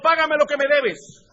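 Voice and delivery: loud, high, choking demand; demanding payment. Acted. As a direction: grab him by the throat and demand it. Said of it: "lo ahogaba diciendo".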